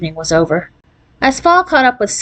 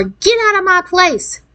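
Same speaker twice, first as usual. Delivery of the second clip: enraged shout; 11 semitones higher than usual. A narrator's reading. Angry New Yorker heard through the window, enraged shout. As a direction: pitch it up like an irate driver. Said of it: "an enraged shout of".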